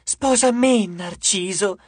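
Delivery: high, pleading fangirl yell; eager, squealing demand; shouting. A narrator's reading. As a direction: cry out high over the rival fans. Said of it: "gridò un'altra ancora".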